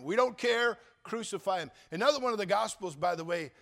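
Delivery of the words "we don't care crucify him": louder, higher crowd shout; shouting mob. Acted. Mob answering Pilate, shouting louder and higher. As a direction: yell it as the angry mob. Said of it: "the mob answers Pilate".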